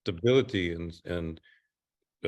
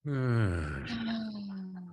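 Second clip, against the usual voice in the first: another sigh; 9 semitones higher, 4 dB quieter than usual. Shown as a demonstration. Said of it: "And do it again".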